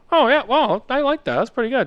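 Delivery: high-pitched dad impression, approving; imitating pleased dad.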